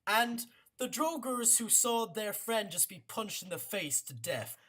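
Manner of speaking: usual speaking voice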